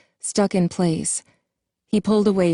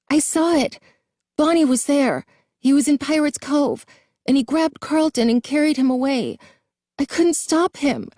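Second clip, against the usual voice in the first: much higher, trembling and tearful; shaking, tearful voice; 9 semitones higher than usual. A narrator's reading. Jason explaining what he saw in Pirate's Cove, tearful and shaking. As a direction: hold back sobs while telling it. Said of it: "his voice shaking".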